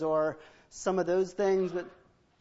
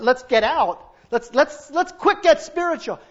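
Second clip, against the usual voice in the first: mock panic; 6 semitones higher, 5 dB louder than usual. Lot in panic.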